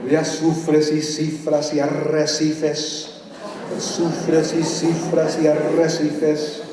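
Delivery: slow, rhythmic Spanish poem reading; dramatic Spanish verse reading; poem recital. Acted. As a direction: chant the verses like an incantation. Reads a Spanish poem aloud.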